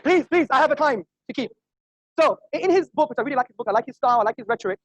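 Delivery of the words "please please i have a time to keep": loud, high appeal over applause. Real, not acted. Debater short on time to noisy audience, calling out. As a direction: plead with the crowd to let you continue.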